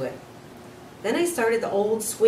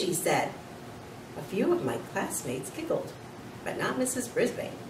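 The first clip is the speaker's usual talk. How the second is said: plain reading voice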